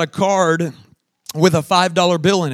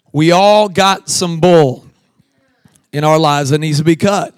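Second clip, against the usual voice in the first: slow louder preaching; 5 dB louder than usual. Real, not acted. Preaching point.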